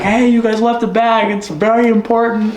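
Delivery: high, urgent retold caller; imitated client call. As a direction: play the anxious client on the phone. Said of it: "He's like".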